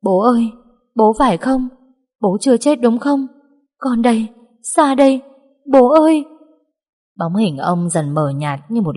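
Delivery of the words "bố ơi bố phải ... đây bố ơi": rising to a desperate high scream; anguished crying out. Acted. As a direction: call out to father in despair, louder and higher. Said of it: "Cô gào lên thảm thiết". (tiếng gào thảm thiết gọi bố)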